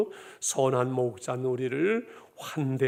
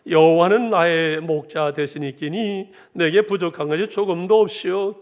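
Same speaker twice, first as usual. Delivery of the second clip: Hamgyeong dialect; 2 semitones higher, 6 dB louder than usual. Retold or imitated. Pastor jokingly reads Psalm 23 to the church in a loud Hamgyeong dialect. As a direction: proclaim it boldly in North Korean Hamgyeong dialect. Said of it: "함경도 버전 하나 더 할까요".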